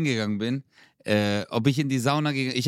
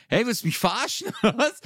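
laughing mock outrage; 9 semitones higher, faster than usual. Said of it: "Ich so".

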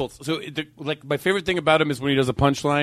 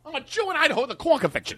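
high, quick mimicry of a punchline; Don Rickles; 9 semitones higher, faster than usual. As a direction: toss it out fast like an insult comic. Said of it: "That's why Don Rickles".

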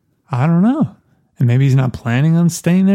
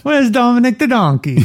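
higher, hammed-up Italian Mama voice; a quick higher Italian mama jab; mock Italian accent; 10 semitones higher than usual.

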